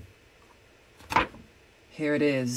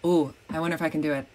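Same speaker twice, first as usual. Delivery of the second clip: ordinary talk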